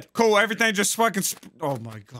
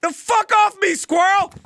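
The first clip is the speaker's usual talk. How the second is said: high, loud gamer rage; real exasperated outburst, pitched high; angry shouting; 9 semitones higher, 3 dB louder than usual. Real, not acted. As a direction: yell furiously at your teammate. Raging at squirrel in game.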